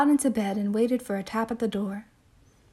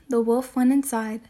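ordinary delivery

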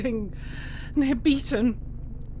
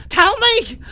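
higher and louder, demanding; angry demand; 7 semitones higher, 6 dB louder than usual. Acted. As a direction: shout the demand at him. Captive demands an answer.

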